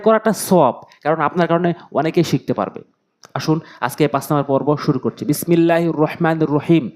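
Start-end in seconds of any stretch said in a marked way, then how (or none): none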